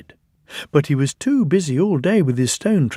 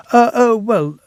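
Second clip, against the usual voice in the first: caught-out Thomas; 9 semitones higher, 5 dB louder than usual. A narrator's reading.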